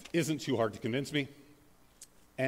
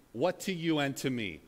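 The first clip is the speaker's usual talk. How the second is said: ordinary talk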